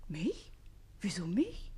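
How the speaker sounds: breathy, startled whisper; puzzled whisper. Acted. Susanne to the page, startled whisper. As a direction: ask it half aloud, puzzled.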